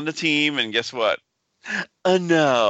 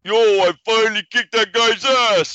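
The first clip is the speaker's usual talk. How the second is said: loud, high, put-on Rocky shout; Rocky impression; 8 semitones higher, 4 dB louder, slower than usual. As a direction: yell it like Rocky after the fight. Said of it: "Rocky Two".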